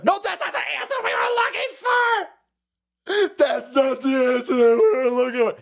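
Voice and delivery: very high, shouted mock wail; mock shrieking outrage. Reporters shriek that the answer is wrong, hammed-up wail. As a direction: screech like an offended reporter.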